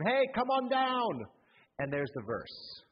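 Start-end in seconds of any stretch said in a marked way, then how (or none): 0.0-1.3 high, slow mock call up to the wall. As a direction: call up to him from below. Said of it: "he's shouting up to him".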